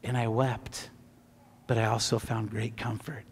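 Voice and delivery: low, soft and hushed; tender, quiet testimony; subdued, moved. Real, not acted. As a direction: let the voice sink with remembered grief. Recalls weeping over dying brother.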